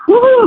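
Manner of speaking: high, loud excited whoop; excited whoo. Retold or imitated. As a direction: let out a gleeful high whoop. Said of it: "like, that kind of noise".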